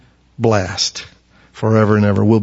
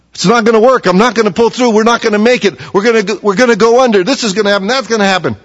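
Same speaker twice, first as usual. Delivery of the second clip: high-pitched, hammed-up fretting; acted panic; 9 semitones higher, faster than usual. Preacher voices the congregation's doubts of six months, mock-panicked. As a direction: rattle off worries in a mock panic. Acting out listeners' panicked thoughts.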